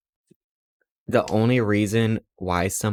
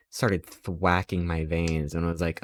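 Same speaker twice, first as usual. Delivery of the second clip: ordinary talk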